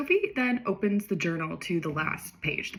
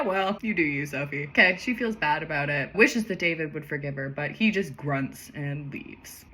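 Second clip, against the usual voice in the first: ordinary delivery, nothing marked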